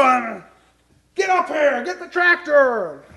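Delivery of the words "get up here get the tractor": high urgent yell, drawn out on tractor; calling out from the roof. Retold or imitated. Preacher on the roof yelling to his family, high urgent call. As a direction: shout down for help, worked up.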